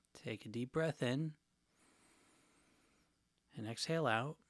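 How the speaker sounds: calm and hushed, guide them gently; soft spa whisper. Acted. Leads a guided eating meditation.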